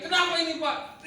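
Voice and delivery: put-on high, loud panicked cry of a boy; frightened son crying out to his father; child screaming. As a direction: shriek it like a terrified child being grabbed. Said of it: "Ishak teriak-teriak".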